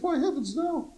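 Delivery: high, startled "heavens, no"; shocked denial. Retold or imitated. Minister waves off the idea, high and startled. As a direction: recoil as if the idea is scandalous.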